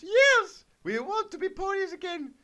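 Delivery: very high, loud eager squeal; squeaky ponies. Acted. The ponies answer the wizard in a high, excited squeal. Twilight and Big Mac answer.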